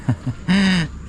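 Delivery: short high run of real laughter; giggling. Real, not acted. Still amused after the shoutout, giggling.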